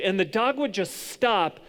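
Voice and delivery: ordinary delivery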